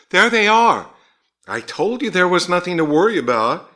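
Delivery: high, loud burst of relief; excited exclamation. A narrator's reading. Husband to anxious wife as the car pulls up, excited. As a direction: pitch leaps up, triumphant and loud.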